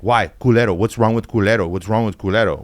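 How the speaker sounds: insistent teacher imitation with bad Spanish accent; mock a baffled teacher, accent and all; mock teacher's accent. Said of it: "with her stupid ass accent".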